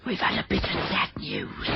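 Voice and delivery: hushed whisper, out of earshot. Acted. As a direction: whisper it so nobody hears. Confiding bad news to doctor.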